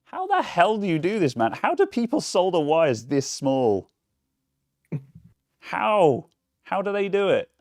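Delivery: high, loud exasperated outburst. Real, not acted. Exasperated at tiny solder wires.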